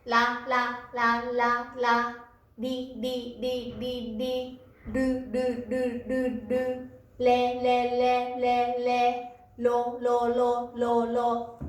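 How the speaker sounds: ラ リ ル レ ロ each repeated in a run, tongue flicked; steady level-pitched drill of tapped ラ リ ル レ ロ. Shown as a demonstration. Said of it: "こう、弾く感じですね".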